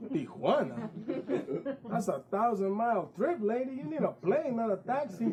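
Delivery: high, laughing disbelief; incredulous taxi driver. Acted. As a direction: scoff at the idea.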